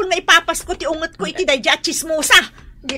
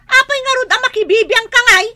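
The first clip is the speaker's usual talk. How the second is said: angry; 9 semitones higher, 3 dB louder than usual. Acted. Neighbor quarrel flares.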